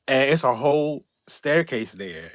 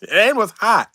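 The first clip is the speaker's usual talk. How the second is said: complaining about the heat; 10 semitones higher, 8 dB louder than usual. Real, not acted.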